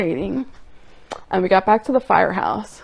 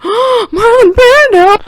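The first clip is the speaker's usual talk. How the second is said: much higher, much louder, slow gasping cry; awed exclamation; 13 dB louder, slower than usual. Retold or imitated. The dream Ghostbusters seeing Brando, much higher, louder awed cry. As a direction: swoon at the sight of him.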